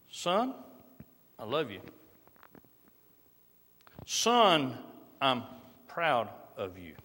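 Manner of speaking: soft, low, gentle words to a son; hushed tender father's blessing. Acted. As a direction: say it softly as a loving dad.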